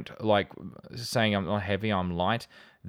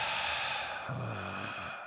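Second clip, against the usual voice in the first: a long, soft exhale to let anger out; long angry exhale; 10 dB quieter than usual. Real, not acted. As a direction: breathe the anger out slowly. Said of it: "That is the sound of me breathing out anger".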